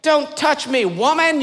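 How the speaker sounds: high comic don't touch me; mock warning. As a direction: play it as a prim protest. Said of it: "to try to signal".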